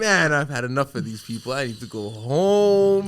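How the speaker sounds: loud, slow, fed-up drag; fed-up sigh. Retold or imitated. Worn out at a party, dragging out that she's going home. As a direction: drag it out like you've had enough of people. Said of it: "I'm like".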